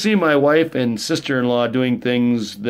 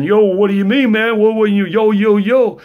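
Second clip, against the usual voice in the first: high, loud street-tough taunt; mock wannabe rapper; 8 semitones higher, 5 dB louder than usual.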